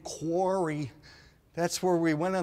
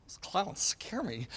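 normal talking voice

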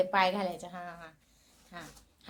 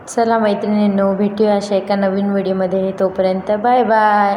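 vlog outro; 3 semitones lower, 8 dB louder than usual. Acted. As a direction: wrap up the video cheerfully for followers, taking your time. Vlog sign-off to viewers.

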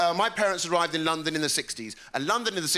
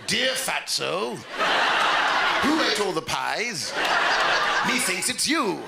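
slow, deliberate mock dictation; bullying letter writer; 2 semitones higher, 3 dB louder, slower than usual. Bully writing a letter to his victim, slow mock-formal. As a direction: compose the insult with drawn-out care. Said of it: "imagine being bullied via letter".

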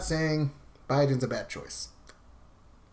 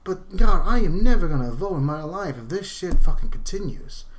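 loud, exasperated outburst; angry rant; 3 semitones higher, 6 dB louder than usual. Real, not acted. Vlogger ranting at both parties, loud and angry. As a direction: let the frustration blow up loud.

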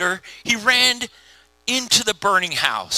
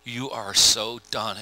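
low, hushed verdict of God; softly final, much lower voice as God; God passing judgment; 8 semitones lower, 7 dB quieter than usual. Retold or imitated. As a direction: play God quietly, dropping well down in pitch. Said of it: "and God goes".

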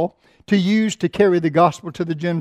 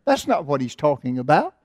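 ordinary talk, nothing marked

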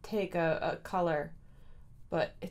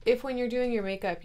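ordinary talk

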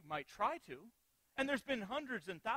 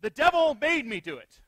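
put-on loud, firm childish retort; a cheeky kid standing his ground, loud and firm; sturdy retort; 5 semitones higher, 16 dB louder than usual. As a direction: blurt it out bold and sure. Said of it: "in the same sturdiness and same tone".

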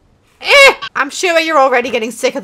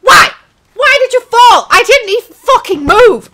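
higher and louder, outraged shout; furious yell; 10 semitones higher, 10 dB louder than usual. Real, not acted. Gamer yells at his character for falling. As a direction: scream at the screen in disbelief.

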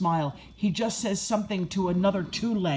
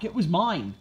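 ordinary delivery

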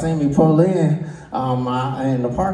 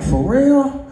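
high shocked; 12 semitones higher than usual. Retold or imitated. Shocked question in story.

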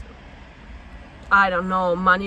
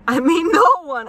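higher and louder, laughing through no one; 9 semitones higher, 8 dB louder than usual. Real, not acted. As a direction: burst into a real laugh mid-line. Laughing at her own point.

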